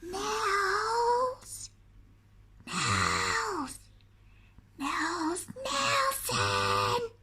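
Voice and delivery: a far-off voice calling through trees; calling from afar. A narrator's reading. Troll calling to Nils from the forest, far off. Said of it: "a voice calling from the forest".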